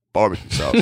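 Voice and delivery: low, macho barbecue voice; deep manly growl on the words. Shown as a demonstration. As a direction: puff it up, say it like a tough guy. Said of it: "you did a thing with your voice to make it more manly".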